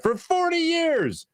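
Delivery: FORTY stretched out high; stress on FORTY. Real, not acted. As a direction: belt out "forty" as a long, high boast. Hammers repeated claim in ad.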